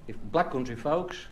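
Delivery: steady, measured reading of a dialect poem; poem recital. Acted. Reciting a dialect poem to the audience, measured poem recital. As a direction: recite it like a rhyming monologue.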